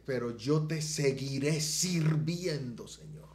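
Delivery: high, loud, slow tearful pledge; tearful vow. Retold or imitated. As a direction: plead with God through tears, slowly. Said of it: "yo le decía". (voto lloroso, alto y lento)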